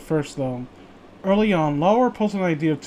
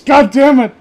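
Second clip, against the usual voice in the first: loud, higher, real annoyance; frustrated outburst; 7 semitones higher, 9 dB louder than usual. Real, not acted. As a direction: blurt it out in annoyance.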